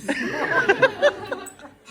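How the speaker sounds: big high cackle; laughing hard at the punchline; loud burst of laughter. Real, not acted. Laughs at the price haggling.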